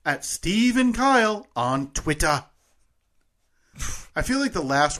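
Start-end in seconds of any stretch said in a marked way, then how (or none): 0.1-2.4 slow, higher British voiceover; drawn-out, lifted British accent; British announcer impression. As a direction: mimic a British announcer, lingering on each word. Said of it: "my word there got a little Rebecca Waddingham".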